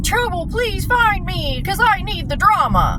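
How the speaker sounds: hammed-up singsong begging; mock pleading. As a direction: sarcastically imitate someone begging for drama. Mocking Dad as begging for trouble.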